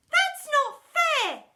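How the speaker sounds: very high, slow, loud wail; wailing child. Acted. Pupil wailing at Miss Seeth, outraged. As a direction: wail the complaint.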